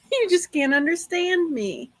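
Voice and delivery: normal talking voice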